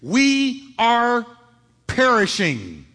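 pressed, emphatic perishing plea. Acted. Disciples pleading with Jesus in the storm, emphatic. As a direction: lean hard on we are perishing.

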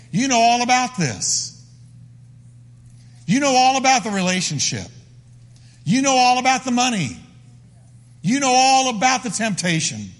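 high, insistent appeal to God; impassioned prayer. Acted. David listing his struggles to the Lord, impassioned and insistent.

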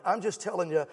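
ordinary talk, nothing marked

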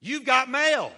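announcing call. Acted. Preacher announces the message.

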